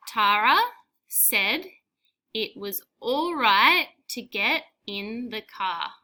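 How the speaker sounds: slow, clearly paced dictation. Shown as a demonstration. Teacher dictating a sentence to children, slow. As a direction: read it out slowly for pupils to write. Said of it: "super sentences".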